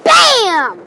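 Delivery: loud, drawn-out "BAM"; booming word stretched out as a sound effect; imitated thunder bang. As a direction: imitate a thunderclap, long and punched. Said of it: "That thing just went".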